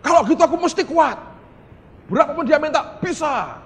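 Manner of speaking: loud, high-pitched mock resolve; hammed-up rash declaration at full voice; emotional overconfidence. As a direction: imitate someone carried away, shouting their commitment. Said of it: "emosi berkata".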